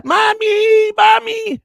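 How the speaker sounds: very high, drawn-out mock yell; hammed-up burnt-out character hollering; yelling for mommy. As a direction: wail like an overgrown child calling for mother. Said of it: "yelling at the top of it".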